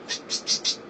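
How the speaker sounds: unvoiced hissing psst sounds; lisped hiss. Shown as a demonstration. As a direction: hiss air through a missing front tooth. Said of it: "Sound like an old drunk".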